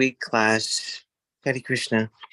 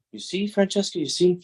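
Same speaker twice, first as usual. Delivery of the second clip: mock scared; 8 semitones higher than usual. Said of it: "she got scared and said".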